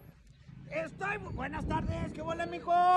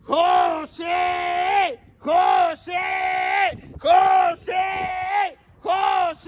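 calling out a name; 2 semitones higher, much slower than usual. Real, not acted. Calling out a birthday cheer.

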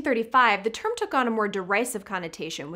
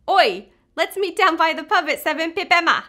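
loud, hammed-up Cockney shout, pitched up; Cockney accent; 6 semitones higher, 8 dB louder than usual. Host playfully does the slang in a loud Cockney accent. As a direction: bellow it like a London pub regular, Cockney. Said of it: "wants to say them in a Cockney accent".

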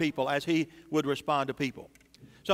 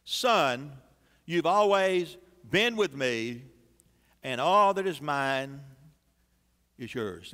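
low, slow, gentle father's voice; tender father; 4 semitones lower, slower than usual. Acted. Father gently reassuring his angry son, tender and slow. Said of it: "And he said to him".

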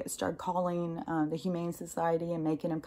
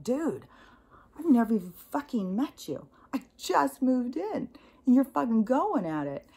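higher-pitched, incredulous retold outburst; indignant retort; 6 semitones higher, 3 dB louder than usual. Newcomer answering a rude neighbor, retold indignation. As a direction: push back in disbelief, voice climbing.

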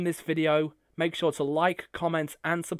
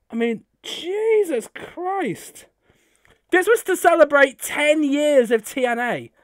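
excited raving; 10 semitones higher, 5 dB louder than usual. Real, not acted. Fan still raving over gift.

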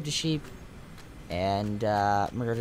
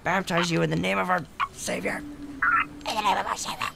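mock preacher; 6 semitones higher than usual. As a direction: intone it like a mock revival preacher. Jokingly baptizes the sheep.

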